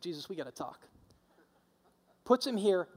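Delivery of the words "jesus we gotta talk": softer, hushed aside to a friend; polite private word. Retold or imitated. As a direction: pull him aside and speak low. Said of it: "Peter takes him aside".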